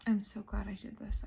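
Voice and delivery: overwhelmed, barely voicing it; choked-up whisper. Real, not acted. Vlogger moved to tears by her own journey, whispering. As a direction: voice dropping to a whisper from emotion. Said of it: "the gravity of this is hitting me".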